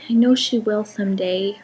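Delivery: ordinary talk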